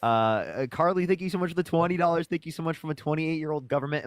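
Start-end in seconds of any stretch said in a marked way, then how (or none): none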